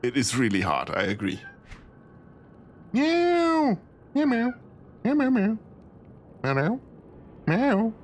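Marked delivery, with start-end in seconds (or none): 2.9-7.9 high little meows; nervous cat voice. Pretend. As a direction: meow like a jittery kitten. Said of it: "Is that better?".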